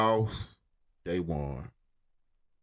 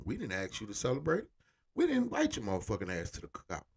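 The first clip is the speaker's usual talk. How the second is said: ordinary delivery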